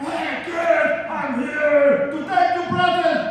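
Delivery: high, loud mock-villain announcement; playful Grinch menace. Grinch surprising a kid at the door, playful loud menace. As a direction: crow it like a gleeful present thief.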